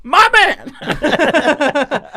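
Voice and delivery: high thrilled cheer; real joyful praise; excited shout. Real, not acted. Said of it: "I was so happy".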